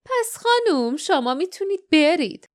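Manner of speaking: high, mock-courteous send-off; dismissive guardian. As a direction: dismiss the lady with lofty indifference, pitched high. Guardian dismisses Nerida with a wave.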